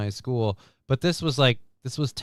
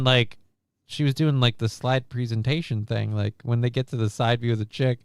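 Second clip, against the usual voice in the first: normal talking voice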